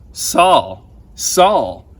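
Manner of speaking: loud, calling the name out twice; booming voice. A narrator's reading. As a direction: call his name like a voice from heaven. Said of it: "said the loud voice".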